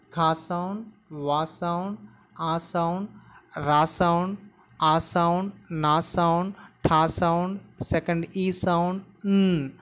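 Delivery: each sound of "quarantine" named separately, evenly; sound-by-sound pronunciation. Shown as a demonstration. Teacher showing learners the pronunciation of quarantine, sound by sound. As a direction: break the word into sounds: "Ka sound, wa sound". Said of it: "The pronunciation would be like this".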